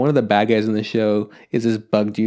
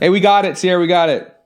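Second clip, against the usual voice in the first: calling out; 6 semitones higher, 4 dB louder than usual. Real, not acted. Calling to Sierra in other room.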